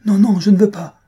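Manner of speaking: put-on high, loud child's protest; panicked refusal. A narrator's reading. Boy to mother threatening to cut his tongue, panicked. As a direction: protest loudly in a high boy's voice.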